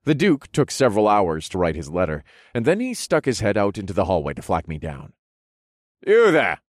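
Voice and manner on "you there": louder, higher hail down the hall; calling out. A narrator's reading. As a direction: flag a servant down loudly. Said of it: "he called".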